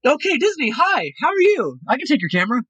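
high, bright greeting voice; a Disney cast member's high, sunny hello to the next guests; cheerful cast member. Retold or imitated. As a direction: snap back into happy guest-service mode. Switches back to performer mode.

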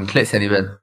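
ordinary delivery

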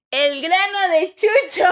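loud, laughing host reveal; mock announcement. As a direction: announce it like a star guest. Mock announcing special guest.

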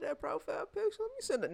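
high mock creepy ex voice; mocking ex's voice. Imitating a creepy ex eyeing her photo, high mock voice.

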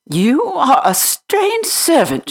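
put-on high, haughty little girl voice; haughty young lady, high and cool. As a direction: look down your nose at the servant. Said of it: "rather haughtily".

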